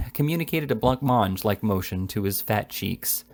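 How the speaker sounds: usual speaking voice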